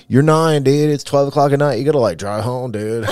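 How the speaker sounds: playing a chill surfer bro; surfer boy voice. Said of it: "You busted the surfer boy voice out".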